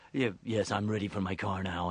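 low, soft announcer voice; announcer off duty. Retold or imitated. Trailer announcer asking for his car, deep voice. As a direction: murmur it down in the announcer register. Trailer guy quoted in passing.

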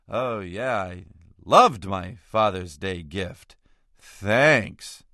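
slow, drawn-out mock gratitude; sarcastic father. Son imitating his father over a Father's Day gift, heavily sarcastic. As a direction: imitate a sarcastic dad, dragging out the thanks. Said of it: "my father's commanding Captain Sarcasm".

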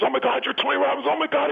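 high, excited fan gasping at a celebrity; starstruck stranger. Retold or imitated. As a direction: blurt it out like a stunned fan. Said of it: "looks at me, goes".

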